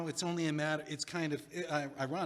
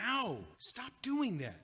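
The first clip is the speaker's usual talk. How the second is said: sharp, higher ow of protest; annoyed yelp; 7 semitones higher, slower than usual. Acted. Hit again by his friend, a high pained yelp.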